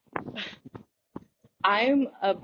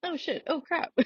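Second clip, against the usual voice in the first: startled high squeak; 12 semitones higher, 6 dB quieter than usual. Real, not acted. Startled reaction at recording start.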